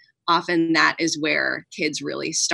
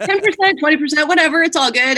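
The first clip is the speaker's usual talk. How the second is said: much higher, louder, carefree tossing-off; breezy joking; 9 semitones higher, 5 dB louder, faster than usual. Retold or imitated. Guest jokes about being bad at math, breezy and glib. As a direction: wave it away in a high, glib voice.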